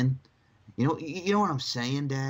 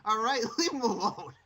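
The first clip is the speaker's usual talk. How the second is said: high-pitched exasperated rant; indignant rant, much higher; 9 semitones higher than usual. Real, not acted. Ranting against Lana.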